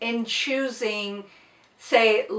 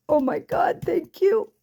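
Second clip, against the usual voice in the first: tearful; 7 semitones higher than usual. Real, not acted. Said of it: "bawled my eyeballs out".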